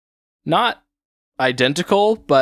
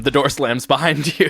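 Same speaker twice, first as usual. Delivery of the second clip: usual speaking voice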